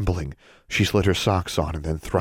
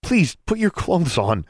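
put-on embarrassed boy voice; 11 semitones higher than usual. A narrator's reading.

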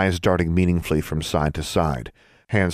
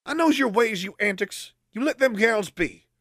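very high scolding woman voice. Acted. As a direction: scold from far off, pitched high.

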